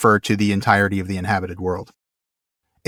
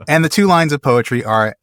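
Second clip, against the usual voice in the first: normal talking voice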